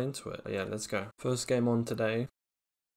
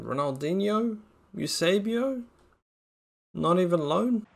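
high loud astonished question; can't believe what he pulled; amazed disbelief; 7 semitones higher, 4 dB louder than usual. Real, not acted.

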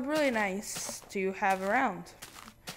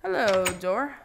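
ordinary talk, nothing marked

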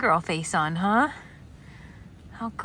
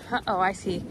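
normal talking voice

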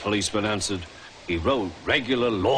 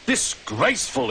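spluttered; 6 semitones higher than usual. A narrator's reading. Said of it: "he spluttered".

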